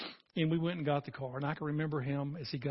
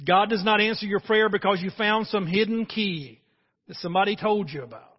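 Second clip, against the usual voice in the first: raised, louder preaching voice, pushing the point; forceful preaching; 4 semitones higher, 9 dB louder than usual. Real, not acted. Pastor stressing to the church, loud forceful preaching. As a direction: preach it out strong over the congregation.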